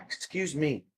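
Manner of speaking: ordinary talk, nothing marked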